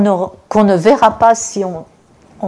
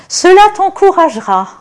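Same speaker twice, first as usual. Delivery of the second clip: raised, higher encouraging voice; encouraging call; 9 semitones higher, 6 dB louder than usual. Acted. Preacher voicing God, loud and encouraging. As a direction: lift it up to cheer the soldier on.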